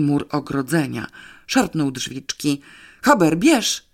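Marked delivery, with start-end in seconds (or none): 3.0-3.9 high, loud order to the dog; shouted command. A narrator's reading. Man sets the dog on an intruder, shouting. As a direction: yell it out urgently as a command. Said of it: "wrzasnął".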